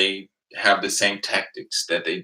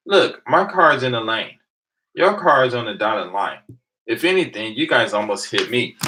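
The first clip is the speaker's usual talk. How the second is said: louder, emphatic self-defence; retold arguing; 4 semitones higher, 6 dB louder than usual. Driver disputes police accusation about the lanes, insistent. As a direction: lay out the case firmly, point by point. Acted argument with police over driving.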